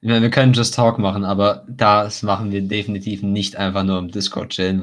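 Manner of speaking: ordinary delivery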